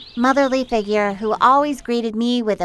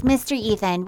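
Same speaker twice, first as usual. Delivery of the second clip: ordinary delivery, nothing marked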